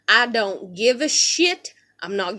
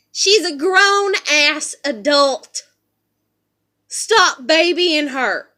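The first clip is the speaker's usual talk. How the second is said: slow, louder, every word pressed; fed-up retort; 4 semitones higher, 5 dB louder, much slower than usual. Retold or imitated. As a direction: lay down the law to mom. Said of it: "I just come within a hair of being like".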